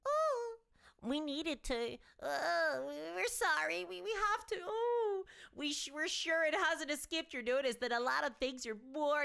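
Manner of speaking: high, sobbing whiny mock apology; fake-weep it like a sorry corporation; mock whining company. Mocking Games Workshop's excuse as a high fake sob. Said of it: "they couch this stuff in a sort of".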